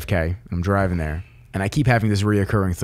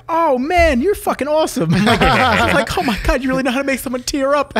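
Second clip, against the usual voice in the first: gushing thanks. Retold or imitated. Gushing over a gifted hat.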